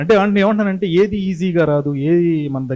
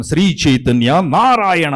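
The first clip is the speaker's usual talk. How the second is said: ad announcer; 6 dB louder, slower than usual. Shown as a demonstration. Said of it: "ads చెప్తూ ఉంటాను".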